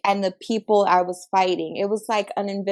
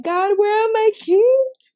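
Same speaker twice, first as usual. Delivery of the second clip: panicked, much higher and louder, slowed down; desperate cry to God while searching; freaking out; 13 semitones higher, 6 dB louder, slower than usual. Retold or imitated. Panicked teller under threat, frantically searching for her keys. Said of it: "freaking out".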